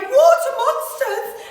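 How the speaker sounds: high, loud frightened cry; scared cry. A narrator's reading. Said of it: "one cried".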